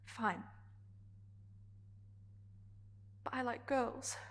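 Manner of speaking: very soft, with a long pause; quiet resignation. Acted. Character conceding to Adrian but staying herself, quietly resigned. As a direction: concede softly, then hold your truth. Acted quiet close of monologue.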